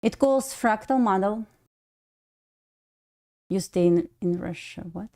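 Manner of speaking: ordinary talk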